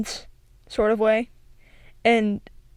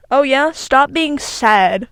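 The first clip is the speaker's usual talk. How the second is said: sarcastic dismissal; 4 semitones higher, 7 dB louder, slower than usual. Retold or imitated. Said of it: "the way that sounds".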